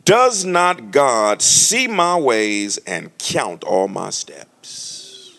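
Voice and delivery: very slow, solemn scripture reading; slow solemn Bible reading. Acted. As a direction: draw out every word of the verse. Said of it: "Job thirty-one four".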